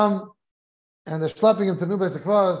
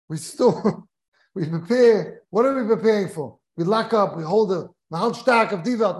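ordinary talk

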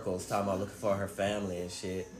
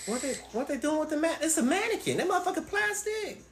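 much higher, can't believe it; incredulous. Real, not acted. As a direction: voice jumps up in shocked disbelief. Reacting to people marrying mannequins.

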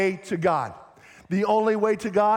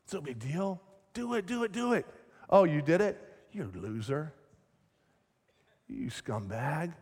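soft, taunting tempter turning to sneer; tempt, then mock the loser; lying enemy voice; 5 dB quieter than usual. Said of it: "the enemy's always gonna plant lies in our head".

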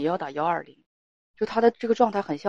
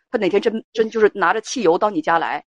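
ordinary talk

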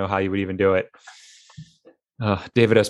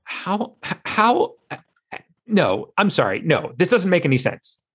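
exasperated disbelief; 7 semitones higher than usual. Real, not acted. Exasperated disbelief over missing link.

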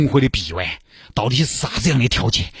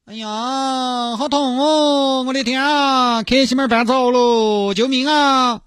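high, slow hammed-up whimper; milk the injury for the handsome man; fake pain; slower than usual. Girl faking pain to attract the handsome man, hammed-up high whimper. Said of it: "你到底在称欢啥子嘛".

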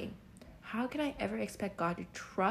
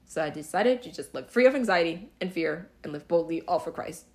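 louder, firm and resolute; bold declaration; 9 dB louder than usual. Real, not acted. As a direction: declare it boldly.

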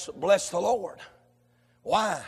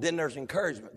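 ordinary talk

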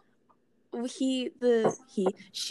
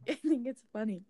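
giggling whisper; whispered giggle; 2 semitones higher, 5 dB quieter than usual. Real, not acted. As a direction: laugh it out under your breath. Laughing whisper aside.

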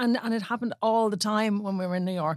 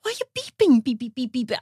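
mock irritation; 2 semitones higher than usual. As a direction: imitate being annoyed at honking drivers. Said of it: "I'm like".

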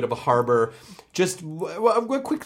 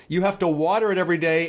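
normal talking voice

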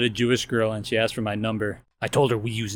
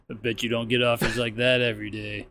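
ordinary delivery

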